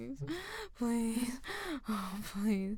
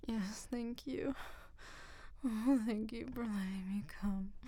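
whispering. Real, not acted. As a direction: whisper it, spent and grateful. Breathless afterglow whisper.

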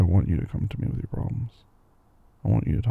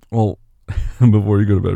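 normal talking voice

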